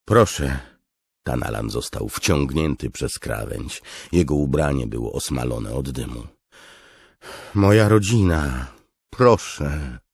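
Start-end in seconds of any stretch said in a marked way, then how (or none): 0.0-0.6 raised, louder plea; pleading. Acted. Captive begs his captor for mercy, pleading. 7.5-10.0 high, loud desperate plea; pleading. Acted. Captive begs the commander for his family, pleading.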